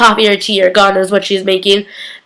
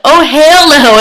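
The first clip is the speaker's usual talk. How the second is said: higher-pitched, emphatic mock protest; mock alarmed refusal; 5 semitones higher, 4 dB louder, slower than usual. Elena sees Connor and runs, imitated alarmed refusal. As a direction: blurt a hammed-up refusal.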